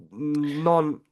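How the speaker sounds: ordinary talk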